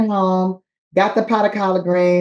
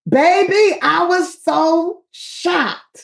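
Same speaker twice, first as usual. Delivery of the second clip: shocked; 9 semitones higher, much slower than usual. Real, not acted. Comic telling her friends how shocked she was on TV. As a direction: stretch it out in mock disbelief.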